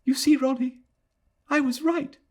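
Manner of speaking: put-on high, light girlish voice; a young woman pressing her point; Adela, pleased to be proven right; young woman's voice. A narrator's reading. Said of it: "said the girl".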